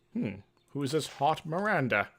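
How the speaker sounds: normal talking voice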